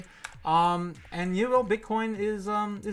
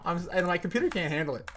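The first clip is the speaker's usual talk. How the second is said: usual speaking voice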